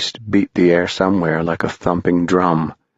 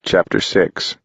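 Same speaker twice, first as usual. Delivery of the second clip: plain reading voice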